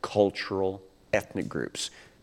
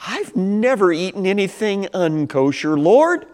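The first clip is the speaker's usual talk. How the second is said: higher, pleading protest; Peter protesting; 7 semitones higher than usual. Retold or imitated. Peter protests to the Lord, higher and pleading. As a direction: plead your case upward. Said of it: "And Peter goes".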